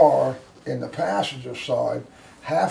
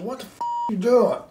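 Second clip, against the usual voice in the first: high yelled curse at you; imitating a screaming man; mock screaming attacker; 7 semitones higher than usual. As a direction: bark it like an angry stranger. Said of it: "Screaming at you".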